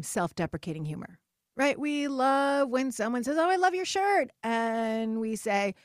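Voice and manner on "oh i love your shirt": high, loud, gushing friend voice; gushing compliment. Retold or imitated. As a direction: gush over her shirt. Women complimenting each other.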